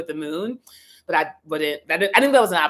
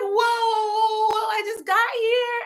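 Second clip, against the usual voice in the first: overwhelmed protest; slower than usual. Retold or imitated. Overwhelmed by angels' gifts.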